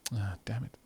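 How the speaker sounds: whispered curse. Real, not acted. Streamer out of money, whispered curse. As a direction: curse it under your breath. Can't afford it.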